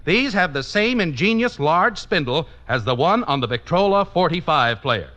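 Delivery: ordinary talk, nothing marked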